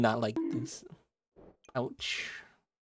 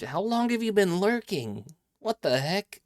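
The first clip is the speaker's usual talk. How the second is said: higher, louder startled question; surprised; 9 semitones higher, 5 dB louder than usual. Real, not acted. Streamer spots a lurker in chat, surprised and higher. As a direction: caught off guard by a lurker.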